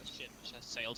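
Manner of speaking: ordinary delivery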